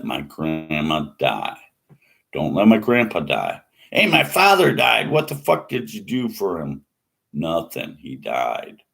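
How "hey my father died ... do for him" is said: loud, high, furious demand; angry shout at God. Retold or imitated. Grieving son yelling at God over his dead father, an angry shout. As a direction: yell it up at the sky.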